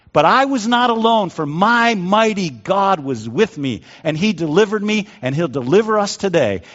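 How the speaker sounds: high, ringing preacher's proclamation; bold declaration. Acted. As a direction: declare it as Moses, full of faith. Moses voiced declaring faith in God.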